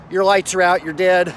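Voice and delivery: normal talking voice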